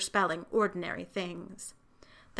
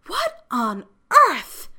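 higher, taken aback; startled disbelief; 7 semitones higher, 9 dB louder, slower than usual. A narrator's reading. Librarian Harriet finding a child shouting, startled. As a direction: exclaim it as a shocked librarian.

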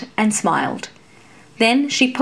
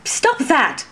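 higher, commanding call; stumbling fencer demanding he stop; 5 semitones higher than usual. A narrator's reading. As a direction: order him to stop. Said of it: "Laéron demanded".

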